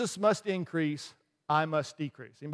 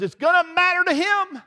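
much higher and louder, like a heartfelt cry; earnest reply; 12 semitones higher, 7 dB louder than usual. Retold or imitated. Beach man answering the arrogant guy, high earnest cry. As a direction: lift it high and loud, pleading for the one starfish.

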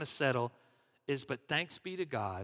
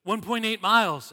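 loud, higher mock disbelief; shocked exclaim; 6 semitones higher, 11 dB louder than usual. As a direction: blurt it out loud, like you can't believe the distance. Retelling a shocked reaction.

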